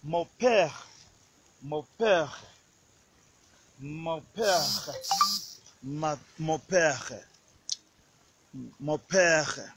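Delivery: mon père with nasal mon and throaty r; French mon père. Shown as a demonstration. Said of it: "This is the French".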